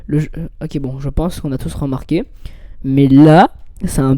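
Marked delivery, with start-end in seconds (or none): none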